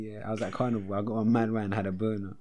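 ordinary talk

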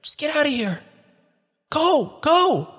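very high, small pleading voice; thin high cry of encouragement; urgent voice from a vat. Acted. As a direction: urge a friend to flee, from a distance. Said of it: "a voice from a vat behind you".

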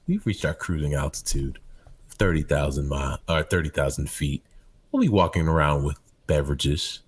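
calm the cabin down gently; soothing pilot announcement. Retold or imitated. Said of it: "P A system are so soothing".

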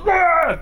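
high mock yell of a fit; mock tantrum yell. Preacher mocks cartoon Jesus throwing a fit, high mock yell. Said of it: "throwing a fit".

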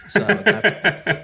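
amused host losing it mid-sentence; laughing. Real, not acted. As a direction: talk while cracking up. Host laughing after rivalry jab.

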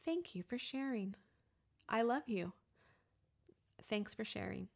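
soft, tender thanks with pauses; gentle acceptance. Acted. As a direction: soothe the inner voice quietly. Soothing reply to shame voice.

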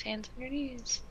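soft, high singsong teacher; kindergarten teacher voice. Pretend. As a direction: coax little kids sweetly.

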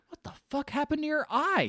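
put-on high-pitched voice, quoting a child; kid. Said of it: "The kid's like".

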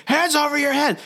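high, strained cop command; mock police shout. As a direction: imitate a frantic officer giving orders. Cops shouting orders at suspect.

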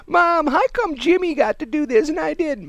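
put-on high, pleading little-kid whine; whiny kid voice. Kid whining to mom about his brother, in a high pleading voice. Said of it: "When I'd come home and go".

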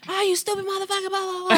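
quick, high mock road-rage rant; a hint of road rage. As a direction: replay cursing out a bad driver, lightly. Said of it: "it's always road rage".

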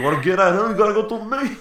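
very high, loud mock challenge; defiant shout. New Yorker shields the hero, high defiant shout. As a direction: yell it like a hammed-up crowd hero.

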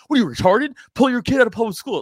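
loud, high-pitched mock berating; hectoring outburst. Activist berating an average parent about public school, loud and hectoring. Mocking blunt confrontational advice.

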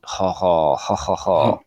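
low, deliberate fake ha-ha; mock laugh. Retold sarcastic laugh at insult.

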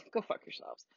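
breathy whisper, sneaked out under the breath; whispered curse. Real, not acted. Woman mutters a curse at the Taliban under her breath. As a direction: hiss it quietly as a private aside. Hushed aside after Taliban quote.

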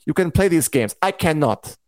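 put-on weird French accent; Mysaria's accent. Viewer mimicking the character for the audience, mock French accent. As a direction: imitate a mangled French accent. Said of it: "Mysaria's accent".

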